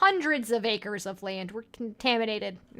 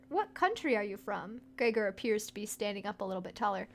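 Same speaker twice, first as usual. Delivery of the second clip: ordinary talk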